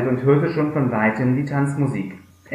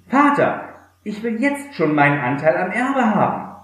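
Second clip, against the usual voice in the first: demanding younger son; 7 semitones higher than usual. Acted. Younger son demands his inheritance.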